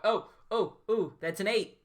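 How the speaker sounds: real delighted outburst; excited surprise. Real, not acted. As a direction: react to a sudden lucky roll. Player rolls a surprise eight.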